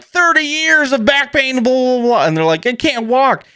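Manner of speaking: hammed-up tale of pain; mock patient testimonial. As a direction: parody a suffering patient. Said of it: "these things where they're like".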